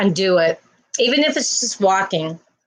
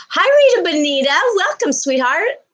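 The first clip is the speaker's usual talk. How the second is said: excited greeting; 8 semitones higher, 3 dB louder than usual. Real, not acted. Greeting a viewer warmly.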